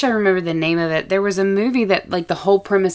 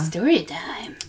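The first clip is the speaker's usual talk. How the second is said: hushed, whispered story opener; put-on storytime voice; 5 dB quieter, much slower than usual. Cohost teasing the start of the story reading, whispered storytime voice. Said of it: "in that accent".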